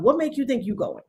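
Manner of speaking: usual speaking voice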